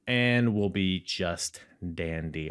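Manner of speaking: usual speaking voice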